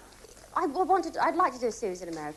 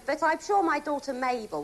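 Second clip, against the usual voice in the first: normal talking voice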